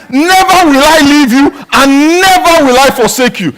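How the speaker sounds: high and loud, stress on each "never"; emphatic. Retold or imitated. Pastor voicing God's promise to the church, emphatic. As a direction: declare it like a vow that cannot break. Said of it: "He was emphatic".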